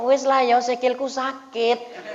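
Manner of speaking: high, slow whiny excuse; excuse-making woman. Retold or imitated. Preacher mimics a woman dodging the handshake, whiny and slow. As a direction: drag it out like a lame excuse.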